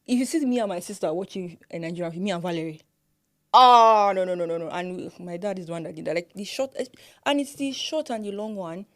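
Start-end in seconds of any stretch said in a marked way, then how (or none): 3.5-4.1 very loud, drawn-out "ah"; higher, emphatic cry of ah; exclaimed ah. Real, not acted. Exclaims about watching with sister.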